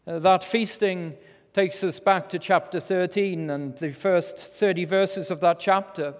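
ordinary talk

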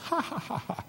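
soft high chuckle; doting laugh. Retold or imitated. Mother laughing over her giggling child, doting laugh. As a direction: laugh fondly at the little one.

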